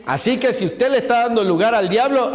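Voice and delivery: normal talking voice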